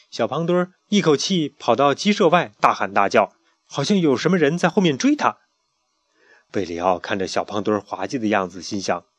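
ordinary delivery, nothing marked

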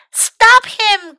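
high, loud urgent call; crying out. A narrator's reading. Pinky sees Mac sliding downhill, crying out. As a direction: call out sharply in alarm. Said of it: "cried Pinky".

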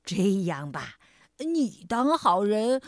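low, soft, slow tiger offer; big tiger voice. A narrator's reading. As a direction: coax gently in a deep voice. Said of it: "大老虎说". (低沉温和的大老虎腔)

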